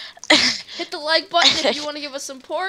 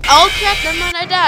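loud, higher startled outburst; startled yelp; 3 semitones higher, 9 dB louder than usual. Real, not acted. Player dying in the game, startled and loud. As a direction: blurt it out loudly in alarm.

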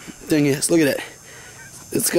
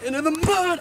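high mock echo; 12 semitones higher than usual. Mocking friend's words.

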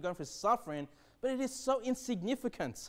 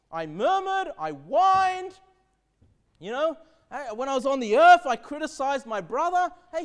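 regretful believer's lament; 7 semitones higher, 8 dB louder than usual. Retold or imitated. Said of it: "you'll be thinking".

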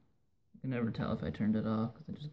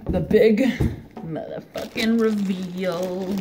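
hyped reveal; 6 semitones higher, 8 dB louder, slower than usual. Acted. Announcing the big reveal.

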